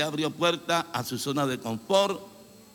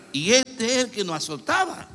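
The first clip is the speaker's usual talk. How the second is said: voz más aguda de gente sorprendida; asombro; 6 semitones higher, 4 dB louder than usual. Acted. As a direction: dilo como quien no puede creerlo. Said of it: "La gente va a quedar sorprendida".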